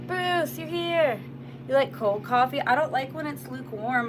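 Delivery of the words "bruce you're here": high, loud, delighted hello; excited greeting. Real, not acted.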